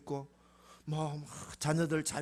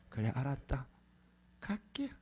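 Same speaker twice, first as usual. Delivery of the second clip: relenting; 2 semitones lower, 7 dB quieter than usual. Acted. God relents, acted.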